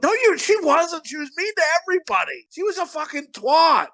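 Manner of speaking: high-pitched, worked-up protest; indignant rebuttal. Acted. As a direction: object in exasperated mock outrage. Indignant rebuttal of funeral praise.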